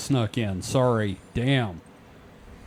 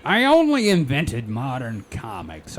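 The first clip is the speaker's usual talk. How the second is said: a hint of a booming old comics showman, louder; hammed-up showman pride, lightly voiced; mock comic legend; 6 dB louder than usual. Riffing on Excelsior, True believer.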